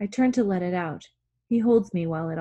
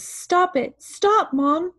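high, loud plea to Mom; scared pleading; 11 semitones higher, 5 dB louder, much slower than usual. A narrator's reading. Scared boy begs his mom to stop, high frightened cry. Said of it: "scared. He says".

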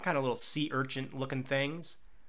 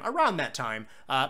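ordinary talk, nothing marked

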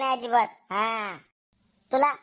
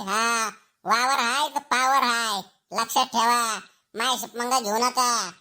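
angry grandfather. Acted. Grandfather threatens the teacher. (नातवासाठी शिक्षकाला धमकावणारे आजोबा, हळू पण रागावलेला आवाज)

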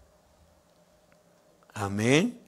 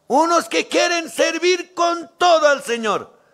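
fervent preaching; 12 semitones higher, 4 dB louder, slower than usual. Acted. Preaching peak.

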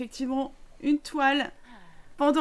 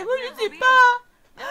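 put-on whiny kid voice; 11 semitones higher, 9 dB louder, slower than usual. Voicing the little sister.

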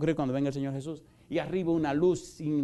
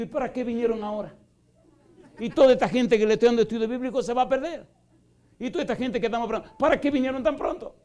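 high, loud, rushed distressed pleading; re-enacted dream panic, pitched way up and fast; anguished plea; 9 semitones higher, 7 dB louder, faster than usual. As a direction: beg the angel in panic, voice climbing. Retold dream, pleading with angel.

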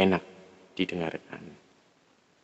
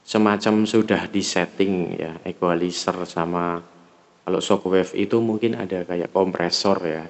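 ordinary talk, nothing marked

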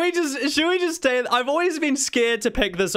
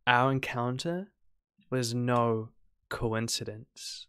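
low, soft, creepy character voice; quietly ominous farewell, pitched down; ominously devoted Penny; 6 semitones lower, 7 dB quieter than usual. Acted.

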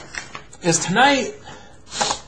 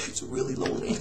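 sad whisper; 8 semitones lower, 10 dB quieter than usual. Real, not acted. Father missing his daughter.

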